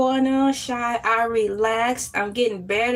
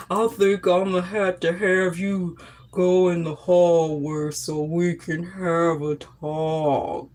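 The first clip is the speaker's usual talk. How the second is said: imitating the teacher; 3 semitones lower than usual. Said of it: "he'll be like".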